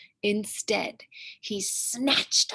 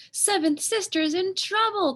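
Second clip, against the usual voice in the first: high, louder urgent cry; alarmed cry; 10 semitones higher, 4 dB louder than usual. Acted. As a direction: sound the alarm for your sister. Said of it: "cried first sister".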